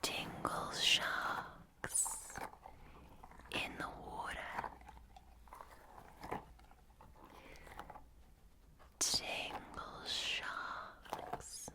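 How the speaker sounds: slow Northern Irish ASMR whisper, sing-song; whispering Northern Irish accent, slowly. Acted. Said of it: "videos in a Northern Irish accent".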